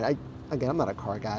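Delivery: sheepish quiet admission; quiet aside. Real, not acted. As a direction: drop the voice low and say it almost to yourself. Repeats disclaimer as a muttered aside.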